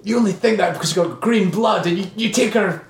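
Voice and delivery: high-pitched mock rant at Spock; hammed-up grumpy bigot voice. Mock racist rant as McCoy.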